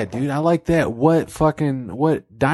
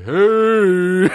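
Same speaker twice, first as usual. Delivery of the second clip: drawn-out higher hey; called-out hey; 4 semitones higher, 4 dB louder than usual. Acted. Comic playing God answering a prayer, drawn-out hey. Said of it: "let me hear you one time say".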